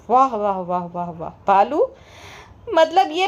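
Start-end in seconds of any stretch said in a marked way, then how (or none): 0.1-1.9 low, dry string of bravos; sarcastic praise. Real, not acted. Vlogger mocking Bhalu for acting born rich, sarcastic praise. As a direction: drop the voice into unimpressed mock applause.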